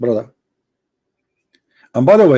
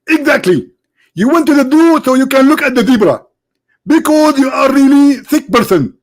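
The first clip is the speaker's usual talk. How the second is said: much higher, indignant preacher voice; Zakir Naik impression; 8 semitones higher than usual. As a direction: play the annoyed preacher being called. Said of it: "Doctor Zakir Naik".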